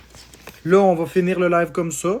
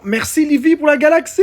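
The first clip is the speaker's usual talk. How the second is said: high, loud burst of gratitude; elated, raised-voice shout-out; excited thanks; 7 semitones higher, 5 dB louder than usual. Real, not acted. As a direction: shout your thanks for a big gift. Thanking viewer for big gift.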